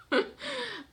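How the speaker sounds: bright burst of laughter; high laugh. Real, not acted. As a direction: laugh at herself. Laughing after story.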